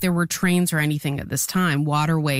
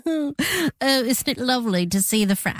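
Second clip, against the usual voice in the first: put-on British accent; 7 semitones higher than usual. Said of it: "I was trying to do a British accent".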